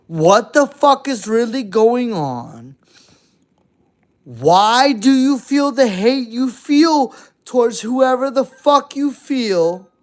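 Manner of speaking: high, loud exasperated question; mock outraged plea. A non-biased party telling haters off, exasperated. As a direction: demand an answer from the haters. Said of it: "A complete non-biased party telling you".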